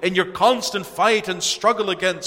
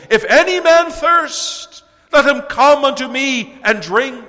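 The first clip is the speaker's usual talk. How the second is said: much higher and louder, slow and impassioned; loud cry; 10 semitones higher, 9 dB louder than usual. Acted. Jesus calling to the festival crowd, crying out loud. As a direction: cry it out across the crowded temple. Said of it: "He cried loudly and perhaps with great feeling".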